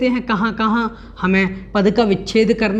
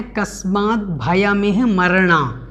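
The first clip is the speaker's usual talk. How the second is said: "कस्मात् भयमेह मरणा" said slowly as one unit, stopping after मरणा; slow careful phrasing that ends on "मरणा"; phrase the line; 3 semitones lower, slower than usual. Shown as a demonstration. Teacher shows students where to break the line, slowly. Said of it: "तो आपको पहले बोलना है".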